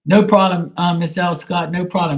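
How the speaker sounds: upbeat shout-out thanking a viewer; cheerful thanks. Real, not acted. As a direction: beam at the viewer as you thank her.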